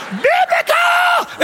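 preacher roaring "Biblical!" to the crowd; preacher's shout. Retold or imitated. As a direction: stretch out "Biblical!" in a huge, slow preacher's holler. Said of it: "he's like".

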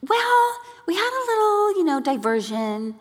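very high, understated explanation; downplay it lightly; husband downplaying the diversion, very high; casual husband. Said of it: "And he goes".

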